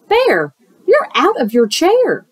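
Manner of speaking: high, bright hare voice, startled; surprised hare. Acted.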